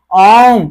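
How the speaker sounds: ओम with its vowel held long and loud; drawn-out vowel. Shown as a demonstration. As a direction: stretch the ओ in ओम. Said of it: "लंबा खींचा जाए".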